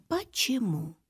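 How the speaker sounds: plain reading voice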